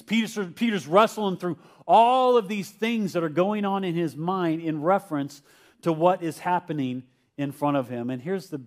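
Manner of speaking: ordinary delivery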